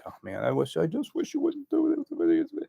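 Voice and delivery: high, slow, timid pleading; a meek, whiny wish that they'd stop; wishy-washy whine. Shown as a demonstration. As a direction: play it weak and wishy-washy. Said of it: "You don't wanna be like".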